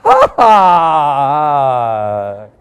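drawn-out, put-on laugh of sudden realization; mock belated laugh. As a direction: fake a late burst of getting the joke. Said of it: "somebody's gonna go".

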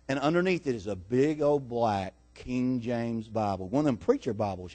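storyteller lowering the voice in suspense; hushed reveal. Real, not acted. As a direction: drop low and soft for the reveal. Hushed reveal of the hidden object.

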